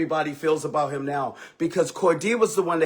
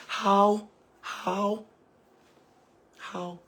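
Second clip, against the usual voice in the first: fading exasperation; 3 semitones higher, 6 dB quieter, much slower than usual. Real, not acted. Repeated exasperated question, fading.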